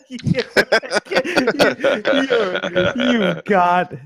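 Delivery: high-pitched, laughing stammer; can barely get the words out for laughing; laughing disbelief. Real, not acted. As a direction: stammer it out through helpless laughter, incredulous. Cracking up at adoption papers.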